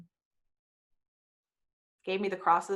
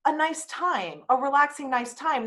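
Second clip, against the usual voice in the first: 1 naive dreamy; 5 semitones higher, 4 dB louder than usual. Retold or imitated. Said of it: "And here I'm all".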